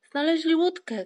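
loud, high cry of discovery; excited shout. A narrator's reading. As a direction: call out the find, high and loud. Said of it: "krzyknął Włóczyki".

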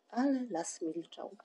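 plain reading voice

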